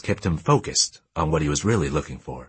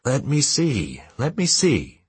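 eager insistence; 8 semitones higher, slower than usual. A narrator's reading. Father rushing over to see find.

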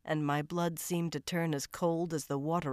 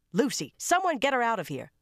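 1 urgent order; 7 semitones higher, 5 dB louder than usual. A narrator's reading. McGovern orders Lucy removed.

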